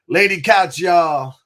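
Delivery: loud, high hype call of the show name; excited shout-out. Real, not acted. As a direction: fire up the crowd with the show name. Host hypes show name.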